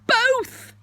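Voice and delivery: high, loud, eager burst; very excited. Acted. Pooh offered honey or milk, excited. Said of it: "he was so excited that he said".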